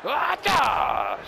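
very high, loud mock karate cry; explosive battle shriek; fight yell. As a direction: yell it as you strike. Acts out a chair blow.

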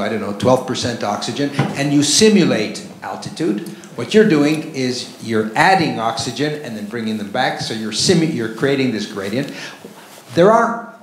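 normal talking voice

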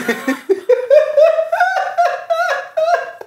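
squealing burst of laughter; high-pitched laughing fit. Real, not acted. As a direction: shriek with laughter. After reading insulting comment.

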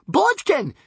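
high, loud shriek. A narrator's reading. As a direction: shriek his name. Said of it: "shrieked the princess".

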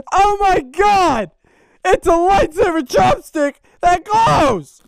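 giddy shock at the gift; delighted disbelief. Real, not acted. Opening fan's lightsaber chopsticks, giddy laughing disbelief.

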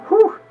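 big high exhale of relief; real relief after surviving the fight; relieved whew. Real, not acted. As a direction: let the tension out in one loud breath.

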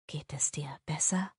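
soft hopeful whisper; hushed bedside whisper. A narrator's reading. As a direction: breathe the question gently. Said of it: "fragt er flüsternd".

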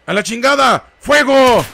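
high, loud order to open fire; worked-up shout. Real, not acted. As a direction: lose your cool and yell it. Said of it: "Perdónenme, me alteré". (grito alterado y agudo)

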